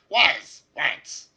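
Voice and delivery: high, loud goblin screech. Acted. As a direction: shriek it like a goblin. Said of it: "in Goblin".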